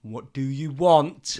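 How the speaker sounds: slow, loud, exasperated shout; a dad at his wits' end with his son; frustrated inner shout. Retold or imitated. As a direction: let the frustration burst out. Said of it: "In my head, I'm like".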